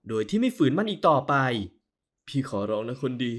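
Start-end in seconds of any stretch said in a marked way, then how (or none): 2.3-3.3 low, soft, gentle coaxing; tender plea. Acted. Husband soothing crying wife, tender and soft. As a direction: hush her gently.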